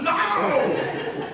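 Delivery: high, loud alarmed no; shouted no. Retold or imitated. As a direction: yell it to stop the kid. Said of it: "I'm like".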